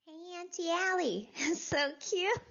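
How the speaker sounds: put-on high-pitched little-kid voice with a giggle; cute child voice. Playfully greeting Auntie Allie as a little kid, cute child voice. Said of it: "why do I sound the same in my normal adult voice".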